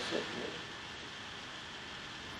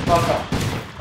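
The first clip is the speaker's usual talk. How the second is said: louder, higher first fuck off; 7 semitones higher, 6 dB louder than usual. Real, not acted. Gamer yelling at teammate to back off, loud first shout. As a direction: snap it out hard. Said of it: "I said fuck off".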